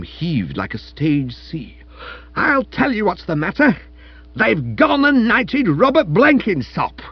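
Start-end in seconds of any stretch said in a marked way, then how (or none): 2.4-7.0 high, loud indignant wail; erupting outrage. A narrator's reading. Wife telling husband of a rival's knighthood, outraged. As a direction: erupt with the scandalous news. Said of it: "She continued to erupt".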